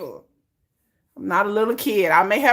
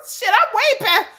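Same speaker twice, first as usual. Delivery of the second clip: laughing; 5 dB louder than usual. Retold or imitated. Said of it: "I'm like".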